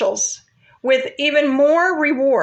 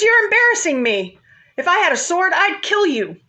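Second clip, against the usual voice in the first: higher-pitched, mildly heated scolding; mock angry; 6 semitones higher than usual. Balaam to his talking donkey, mildly acted anger. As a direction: scold the donkey with a touch of temper. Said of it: "Balaam's really mad now".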